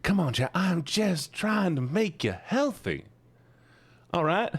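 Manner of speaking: high, slow, drawn-out plea; exasperated pleading. Real, not acted. Streamer begging chat to cooperate, exasperated pleading. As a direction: plead with them, stretching the words upward.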